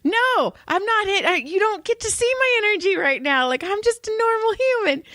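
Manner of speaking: high rapid no no no, backing away in mock panic; panicked protest. Refusing to have her energy taken.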